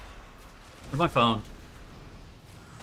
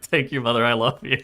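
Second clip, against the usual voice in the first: ordinary talk, nothing marked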